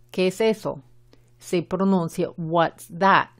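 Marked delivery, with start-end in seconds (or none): 2.4-3.3 "that" with a short A and barely sounded final T; "that" with its last T left unfinished; short English A. Shown as a demonstration. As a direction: model "What's that?" with the short A in "that". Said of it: "la A en la palabra that es la A".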